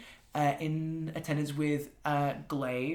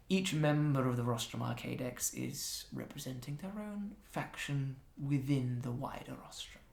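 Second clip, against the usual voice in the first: soft, hushed enchantress; Adoration's voice; 7 dB quieter than usual. Acted. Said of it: "Adoration step forward and goes".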